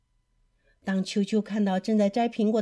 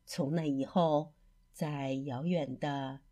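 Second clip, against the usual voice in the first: ordinary delivery, nothing marked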